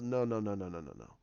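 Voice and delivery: string of noes sinking low and soft; quiet, lightly acted villain's refusal; retold calm dismissal. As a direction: wave them off, fading down low. Snoke dismisses his men, retold.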